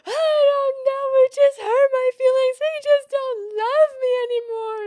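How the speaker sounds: high, slow, loud sobbing whine; exaggerated self-pity, crying; mock crying whine. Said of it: "me going".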